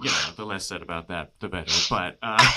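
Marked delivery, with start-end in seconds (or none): 1.9-2.6 loud, laughter breaking into the words; laughing. Real, not acted. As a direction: talk on through real laughter. Laughing over an awkward name slip.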